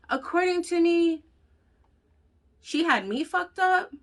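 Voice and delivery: high, mocking sarcastic voice. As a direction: sneer out her version of events. Mocking friend's version, sarcastic.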